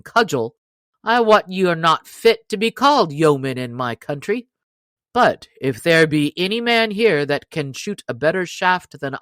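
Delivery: loud, challenging yeoman voice; proud stranger. Acted. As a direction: throw the boast back at him. Stranger boasts back to Robin.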